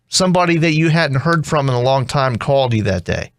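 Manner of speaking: normal talking voice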